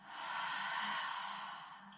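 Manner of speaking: long, airy exhale through the mouth; long sigh out. Shown as a demonstration. Teacher showing how to exhale out the mouth, long sigh. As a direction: sigh the whole breath out. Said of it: "sigh out the mouth".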